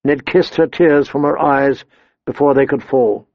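plain reading voice

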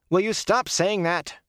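high, slow exasperated shout. Acted. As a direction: snap it out, fed up. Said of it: "Rand shouted".

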